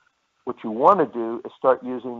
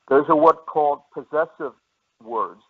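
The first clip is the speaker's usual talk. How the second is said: normal talking voice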